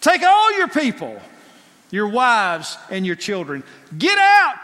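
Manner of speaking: loud, high barking command; barked order. Acted. Pharaoh barking at Moses, loud angry command. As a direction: bark it like a furious king. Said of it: "He's barking the orders".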